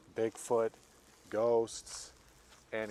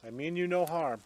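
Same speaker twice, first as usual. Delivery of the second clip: loud, raised peaceful announcement; calling out; 6 semitones higher, 6 dB louder than usual. Real, not acted. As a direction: call ahead as you approach. Calling reassurance to unseen presence.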